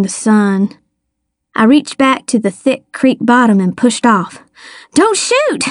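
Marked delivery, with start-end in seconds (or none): 4.9-5.6 very high, strained wheeze; wheezed plea. A narrator's reading. Child surfacing from the creek begging, high wheeze. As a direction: squeeze out a plea, short of breath. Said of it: "I wheezed".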